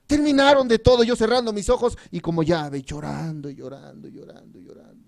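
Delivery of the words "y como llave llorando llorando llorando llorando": trailing into a hush; fading away. Retold or imitated. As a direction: voice sinks lower and softer with each repetition. Retelling his tearful repentance, fading.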